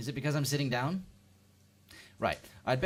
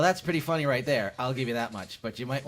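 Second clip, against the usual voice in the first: ordinary delivery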